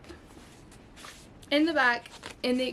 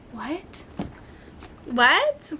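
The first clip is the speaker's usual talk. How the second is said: astonished; 3 semitones higher than usual. Real, not acted. Disbelief at cheap price.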